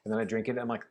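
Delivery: normal talking voice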